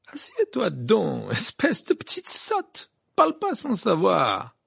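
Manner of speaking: slow, drawn-out scorn; cold put-down. A narrator's reading. Rita puts Hermione in her place, coldly scornful. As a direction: put them down fiercely, word by word. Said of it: "répliqua froidement Rita Skeeter avec un regard féroce".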